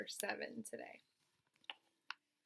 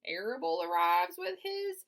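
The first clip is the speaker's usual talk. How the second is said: put-on old sheep voice; 9 semitones higher, 6 dB louder than usual.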